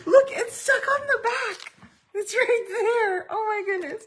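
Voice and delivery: high, loud, laughing through words; laugh-crying. Real, not acted. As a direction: squeal it out between laughs. Laughing-crying at found stamp.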